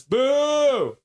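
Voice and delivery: long, high hooting boo; high drawn-out boo. Retold or imitated. Host reading Thomas's chat boo, booing high. Voices another viewer's boo.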